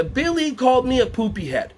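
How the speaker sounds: lightly voiced tattletale; tattling child. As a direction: tell on Billy like a little kid. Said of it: "she comes up to you and goes".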